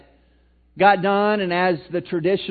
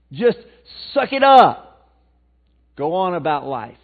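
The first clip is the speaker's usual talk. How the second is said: mock stern advice; 9 dB louder, slower than usual. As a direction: bark the world's bad advice.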